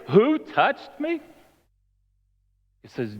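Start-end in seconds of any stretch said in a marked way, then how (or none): 0.1-1.2 slow, high, rising mock question; incredulous echo. Disciples quote Jesus back to him, incredulous. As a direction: throw the question back, drawn out in disbelief.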